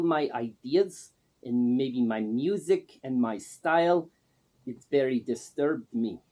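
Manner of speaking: broken English in a put-on Russian accent; Man Gaga's Russian accent. Man Gaga introduces himself to the interviewer in a put-on Russian accent. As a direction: play a self-styled star with a thick fake Russian accent. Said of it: "The first thing I notice is the accent".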